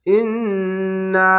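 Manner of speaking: ইন্না with the doubled n held long through the nose; nasal ghunna. Shown as a demonstration. Teacher demonstrating ghunna to students, held nasal. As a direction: hum the ন্ন in ইন্না for a full beat. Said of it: "তিন আলিফ টেনে পড়বেন".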